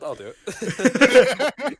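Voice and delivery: laughing while saying it. Real, not acted. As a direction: laugh through the words. Laughing through agreement.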